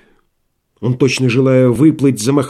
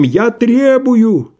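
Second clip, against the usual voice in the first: крик; 10 semitones higher, 4 dB louder, much slower than usual. Acted. Рагин кричит в дверь.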